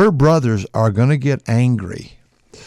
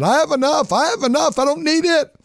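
much higher, pleading voice of brothers giving in; brothers reconciling. Retold or imitated. Jacob and Esau telling each other they have enough, in a high pleading voice. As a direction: raise it high like estranged brothers finally letting go. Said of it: "they finally said".

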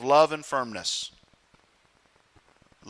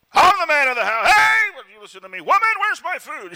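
higher, louder hammed-up shouting tyrant; mock tyrant husband; 9 semitones higher, 6 dB louder than usual. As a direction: bark orders like a pretend household tyrant. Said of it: "rule with an iron fist as tyrants".

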